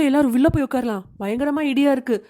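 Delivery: normal talking voice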